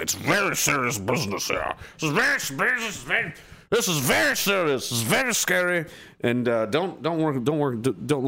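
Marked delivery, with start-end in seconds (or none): 0.0-5.9 high, mock-grave voice mocking how seriously people take titles. Streamer mocking people who take titles seriously, in mock grave voice. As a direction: ham up very serious business.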